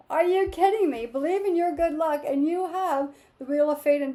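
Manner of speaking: bubbling over with delight at the cards; real thrill over a lucky reading; getting excited. Real, not acted. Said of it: "I'm getting all excited".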